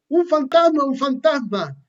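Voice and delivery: high, loud panicked cry of the ghost; terrified cry. A narrator's reading. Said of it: "Llenos de miedo gritaron".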